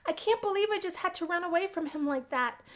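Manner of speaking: ordinary talk, nothing marked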